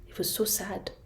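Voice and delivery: soft whisper; hushed sad narration. A narrator's reading. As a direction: breathe it out sadly. Narrating the tortoise's sadness.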